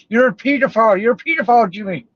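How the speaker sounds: high, mocking sing-song accusation; mocking accuser. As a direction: parody the accuser with a put-on voice.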